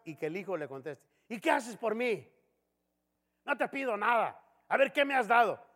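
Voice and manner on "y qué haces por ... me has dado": higher, defiant talking back; teenage son snapping back, insolent. Retold or imitated. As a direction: sass the father rudely. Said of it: "un hijo le contesta así".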